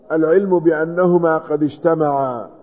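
normal talking voice

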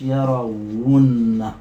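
very slow, low يرون with held doubled n; drawn-out word. Shown as a demonstration. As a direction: stretch out the final form for the class. Teacher sounds out final form. (يرونّ ببطء مع مدّ الغنة)

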